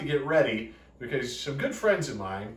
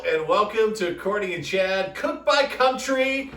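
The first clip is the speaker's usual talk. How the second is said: show host intro; 5 semitones higher, slower than usual. Acted. Show opening greeting.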